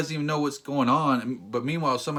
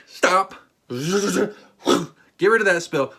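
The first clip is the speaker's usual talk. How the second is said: mock shout; 3 semitones higher, 6 dB louder than usual.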